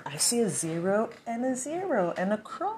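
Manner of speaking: eerie high murmur; odd high voice. Pretend. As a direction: read it in a strange small voice. Strange high voice, reading something.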